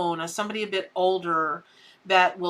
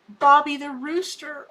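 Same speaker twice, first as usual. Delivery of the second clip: excited reveal; 6 semitones higher, 4 dB louder than usual. Real, not acted. Naming the card with excitement.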